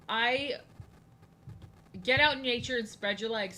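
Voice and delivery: ordinary delivery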